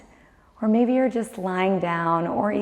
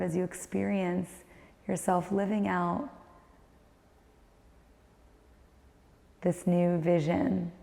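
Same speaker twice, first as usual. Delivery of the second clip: guided meditation; 6 dB quieter than usual. Acted. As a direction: guide the listener inward, calm and unhurried.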